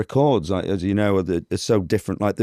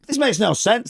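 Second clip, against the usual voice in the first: mock objection, pitched right up; arguing; 14 semitones higher than usual. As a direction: imitate a contestant's high-pitched protest. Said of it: "we all argue".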